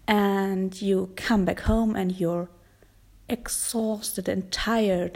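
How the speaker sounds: normal talking voice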